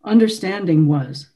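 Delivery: usual speaking voice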